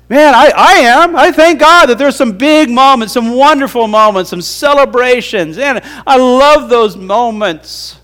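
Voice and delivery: high, loud rejoicing, slowing at the end; joyful exclamation. Real, not acted. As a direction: celebrate God's good moments with fervour. Preacher rejoicing at high point.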